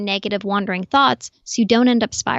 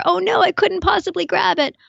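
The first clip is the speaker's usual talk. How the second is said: mock panic; 9 semitones higher than usual. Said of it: "that sensation that you have".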